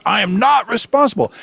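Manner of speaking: dad imitating his daughter refusing the blame; indignant child. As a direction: squeak it out like a stubborn little girl. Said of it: "And she goes".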